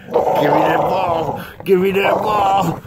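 high, drawn-out playful yell; mock demanding shout. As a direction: tease the dog with a loud, stretched-out demand. Mock fight with dog over ball.

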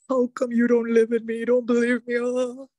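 high, hurt whine; mock hurt parent. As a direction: whine like an offended mother. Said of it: "and we're like".

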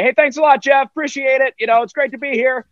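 high, bright-eyed thank-you from someone lost on camera; oblivious speaker. Retold or imitated. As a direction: overeager guest who can't find the lens.

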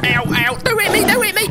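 high, loud panicked pleading; yelping as he gets hit. Real, not acted. Gamer hit by Bigfoot, yelping high in panic.

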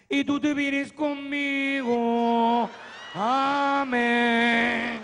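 a voice from beyond summoning him; drawn-out eerie summons; ghostly voice. Retold or imitated. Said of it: "una voz que decía".

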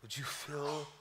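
soft, low, hushed prayer with long pauses. Real, not acted. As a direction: pray quietly to God, unhurried. Leads the congregation in prayer.

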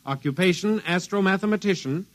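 steady official announcer read; radio bulletin read. Acted. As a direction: call it out to all agencies, measured. Broadcast continues.

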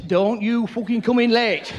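slow menacing warning; stern landlady. Retold or imitated. B and B owner warning guests about curfew, slow menace. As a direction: spell the threat out slowly. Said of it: "and they say".

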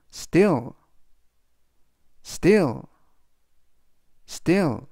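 "still" said clearly, with a crisp st and a long l; teacher models "still" three times; model pronunciation. Shown as a demonstration. Teacher repeats "still" for students, slow and clear. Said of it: "이라고 발음하시면 되겠습니다".

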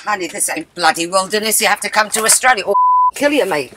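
broad Aussie, quick and blunt at the end; Australian accent. Acted. Aunt mocking the Maltese wilderness in an Australian accent. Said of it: "I love your exotic accent".

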